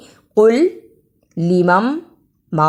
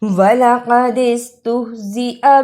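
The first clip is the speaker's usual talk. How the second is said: Quran recitation; 2 semitones higher than usual. Shown as a demonstration. Teacher reciting Quran verse to students, melodic chant.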